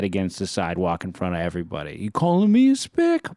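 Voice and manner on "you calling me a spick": high, slightly louder mock tough-guy challenge; retold threat. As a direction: bark it like the feisty dad. Father confronting man, retold.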